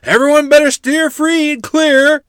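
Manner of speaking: high, very loud hollered warning; gruff hoot. A narrator's reading. Great-uncle warning everyone to steer clear, hooting. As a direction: hoot it out to the whole crowd. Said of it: "Royal hooted gruffly".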